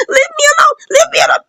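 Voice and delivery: high-pitched acted scream. Beaten man to his attacker, screaming. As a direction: shriek it in imitation of a beaten man. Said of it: "the guy was screaming".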